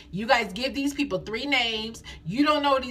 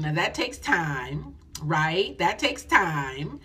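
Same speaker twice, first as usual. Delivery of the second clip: lower, slow and deliberate; slow emphasis; 4 semitones lower, much slower than usual. Real, not acted. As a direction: stretch each word out slowly.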